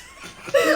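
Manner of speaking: sudden high-pitched laugh; high burst of laughter. Real, not acted. Laughs at own joke.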